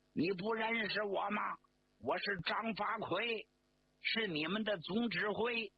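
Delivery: calling out loudly. Acted. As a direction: shout back to assert his rank. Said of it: "远远的高声喊道".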